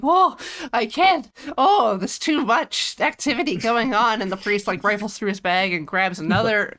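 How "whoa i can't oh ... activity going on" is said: slow, high, fainting lady; swooning aunt. Retold or imitated. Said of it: "She's just like".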